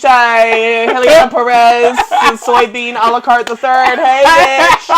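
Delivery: loud, high, hammed-up name announcement; playful shouted roll call of names; mock grand greeting. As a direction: hail a friend across the room, hammed up. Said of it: "she's gonna be like".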